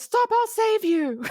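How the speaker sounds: high, slow mock-heroic cry; imitated rescuer's call. Retelling the man running to the rescue, hammy cry. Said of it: "Jim does this thing where he, like, runs and goes".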